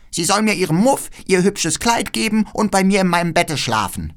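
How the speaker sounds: viel höher, fordernd; trotziges Räubermädchen. Acted. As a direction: quengelnd wie ein verwöhntes Kind. (Räubermädchen verlangt Gerda zum Spielen: hoch und fordernd)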